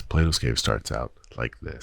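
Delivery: low-pitched story intro voice; storytelling voice. Acted. Storyteller opening the tale of Plato's Cave, low narrator voice. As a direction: drop low to open the tale. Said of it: "I like that voice".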